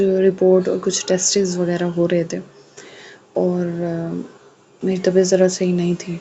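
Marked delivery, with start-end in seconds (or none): none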